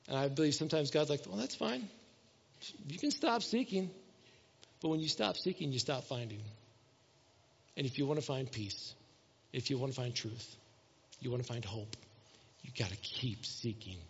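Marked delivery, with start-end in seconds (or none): 1.3-3.9 much softer, calm and resigned; soft God voice. Retold or imitated. God telling the tired seeker to stop, softly. 4.8-14.0 much softer and lower, earnest; hushed plea. Real, not acted. Preacher urging the church to keep seeking, hushed.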